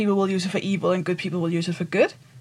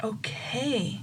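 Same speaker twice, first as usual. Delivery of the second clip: whispered okay; 10 dB quieter than usual. Real, not acted. Stunned reaction to the diary quote.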